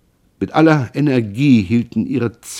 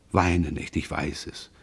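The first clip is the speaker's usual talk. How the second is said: tender comfort; 6 semitones lower, 9 dB quieter than usual. A narrator's reading. Son comforts weeping mother.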